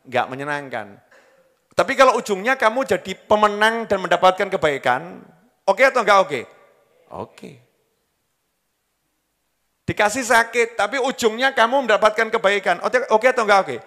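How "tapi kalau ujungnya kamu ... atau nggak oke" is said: high and loud, driving the question home; preaching at full force. Acted. Preacher rousing the congregation with a question, high and loud.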